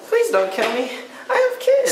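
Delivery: high, frantic pleading; panicked begging. Pretend. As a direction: beg for your life in panic. Begging during scary initiation.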